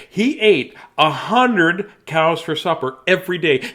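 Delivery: storybook voice; story narration. Acted. Reads the story aloud.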